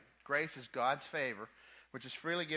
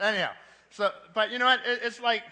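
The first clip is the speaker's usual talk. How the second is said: much louder, higher burst to retake the floor; loud emphatic surge; 7 semitones higher, 13 dB louder than usual. Real, not acted. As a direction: hit it hard to grab the room. Preacher surges back to point.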